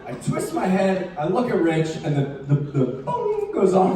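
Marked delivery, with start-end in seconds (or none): none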